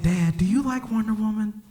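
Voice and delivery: put-on high, innocent child's question; little boy. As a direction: pitch it up as a curious son. Voices young son asking.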